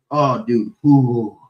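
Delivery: ordinary delivery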